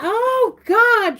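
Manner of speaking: sudden high, loud gasping break; real surprise. Real, not acted. Reader startled by something in the cards, real surprise. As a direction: caught off guard mid-sentence. Trinket surprise mid-reading.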